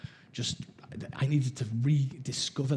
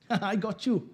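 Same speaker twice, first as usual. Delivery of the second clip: lightly voiced cheeky nan, pitched higher; 8 semitones higher, slower than usual. Nan turns round after hitting him with the handle, cheeky. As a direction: imitate a gleeful, teasing nan. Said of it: "my nan's a bit cheeky".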